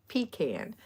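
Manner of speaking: "pecan" as PEE-can, with a long EE. Retold or imitated. Quoting the coastal side of Georgia, "pecan" as PEE-can. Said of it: "people on the coastal side".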